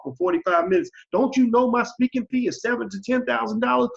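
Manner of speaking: high-pitched mock indignant rant; mock outrage. Himself mocking an offended speaker turning down an offer. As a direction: rant like an insulted diva over a lowball fee. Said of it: "And I say".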